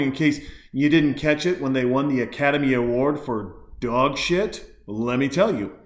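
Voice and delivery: ordinary delivery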